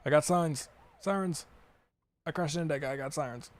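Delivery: high, loud alarm at the sirens; excited. Real, not acted. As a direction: yelp it out in alarm. Police sirens after a crash in game.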